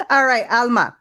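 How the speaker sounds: usual speaking voice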